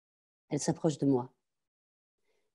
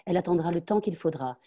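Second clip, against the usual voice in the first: ordinary delivery, nothing marked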